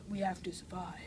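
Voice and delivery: hushed, deadly serious survivor; imitating a solemn character in a whisper; grave mock whisper. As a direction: whisper it like a grim zombie-film hero. Acting out serious zombie characters.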